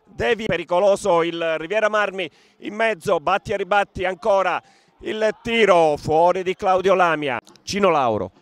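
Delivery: fast running match commentary; live play-by-play. Acted. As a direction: call the action as it happens.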